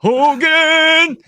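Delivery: high, loud, drawn-out German bellow; mock German accent. Co-host showing what their German accent sounds like, mock German accent. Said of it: "my German accent sounds like".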